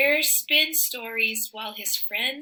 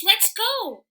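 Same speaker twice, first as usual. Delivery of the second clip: shout; 9 semitones higher than usual. A narrator's reading. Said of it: "he shouts".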